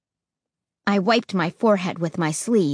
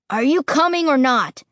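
louder, higher impatient demand; 5 semitones higher, 4 dB louder than usual. Acted. Josh demanding the others follow, louder and higher. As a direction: push them for an answer now. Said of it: "Josh demanded".

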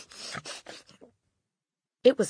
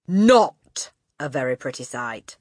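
slow, louder sneer of distaste; utter distaste; 9 dB louder than usual. A narrator's reading. Headmistress looking over the pupils, with utter distaste. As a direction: draw it out with distaste. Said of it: "Her expression was one of utter distaste".

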